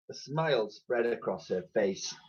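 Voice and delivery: usual speaking voice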